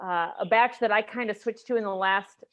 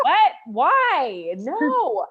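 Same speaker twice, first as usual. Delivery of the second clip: shocked disbelief; 5 semitones higher, 5 dB louder, much slower than usual. Retold or imitated. Retold reaction to Toronto.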